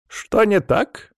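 high, drawn-out rising question. A narrator's reading. Grandfather asks his granddaughter what is wrong, questioning. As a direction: ask it slowly with lifted pitch. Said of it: "вопросительно приподнял брови он".

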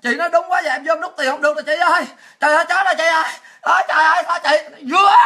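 mock lament building to a high, loud wail; mock crying. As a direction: whine like a panicked caller in tears, hammed-up. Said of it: "Có nhiều người vô khóc".

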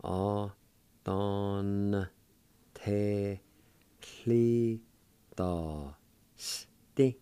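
very slow teaching demo, syllables separated. Shown as a demonstration. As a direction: stretch every syllable apart. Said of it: "pronounce the N sound with the D A like this".

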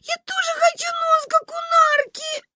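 put-on very high, wailing child; howling. A narrator's reading. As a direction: bawl out your grievance. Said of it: "завыла Маня".

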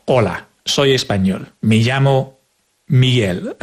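native Spanish speaker, quick and fluent; Spanish accent. Shown as a demonstration. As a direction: say it like a real Spaniard. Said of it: "So, for example, let's imagine I'm Spanish".

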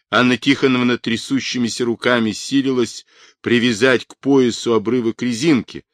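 usual speaking voice

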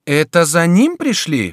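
high, loud, slow question; alarmed exclamation. A narrator's reading. Duchess asking if rescuers came for the king, crying out. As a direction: cry out the question. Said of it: "вскричала герцогиня".

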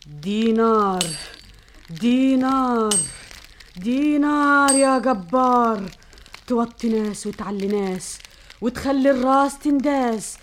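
slow chanting sorceress summoning; drawn-out spell, calling the demon; witch incantation. Acted. (تعويذة ساحرة بطيئة)

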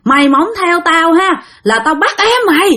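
high-pitched mock scolding of a menacing ghost; retold angry scolding. Ghost chasing the dreamer to grandma's house, scolding. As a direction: scold and threaten, pitched high, without really shouting. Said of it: "Thì ổng cứ chửi bạn rằng".

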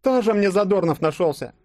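high-pitched scornful character voice; sarcastic retort. Acted. Yulia putting down the would-be comedian, sarcastic retort. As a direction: throw the joke back with high, loud scorn. Snorting back at his joke.

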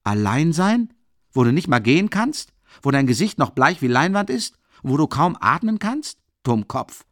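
angry scolding. A narrator's reading. Friend furiously scolding the sick man.

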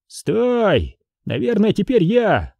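high-pitched call to stop; вражеский солдат. A narrator's reading. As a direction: call out to halt the countdown. Enemy soldier calls out hastily. (выкрик высоким голосом: стой)

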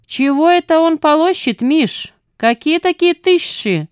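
nasal, puzzled henchman asking; nasal thug. A narrator's reading. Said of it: "гнусаво спросил".

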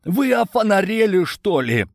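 high-pitched outraged question; indignant outcry. A narrator's reading. Prisoner protests to his captors, indignant outcry. Locked-up man shouts in outrage.

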